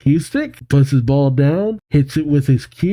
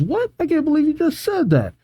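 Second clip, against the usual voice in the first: high, squeaky mock outrage; hammed-up offended squeal; appalled disbelief. Crystal appalled at the remark.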